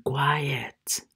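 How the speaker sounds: hushed whisper; soft, voiceless teaching word; whispered demonstration. Shown as a demonstration. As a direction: whisper it to show the meaning.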